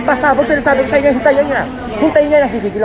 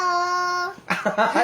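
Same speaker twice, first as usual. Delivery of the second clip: high giggling burst; delighted high laugh; real laughter; 6 semitones higher, 8 dB quieter than usual. Real, not acted. Laughs at child saying tito.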